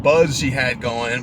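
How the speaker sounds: normal talking voice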